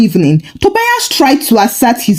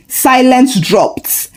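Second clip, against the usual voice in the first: ordinary delivery, nothing marked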